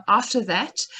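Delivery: ordinary delivery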